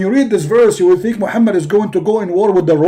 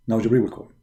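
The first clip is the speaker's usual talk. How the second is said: ordinary delivery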